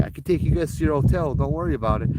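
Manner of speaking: chirpy driver offering a ride; retold eager offer to riders. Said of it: "I'm like".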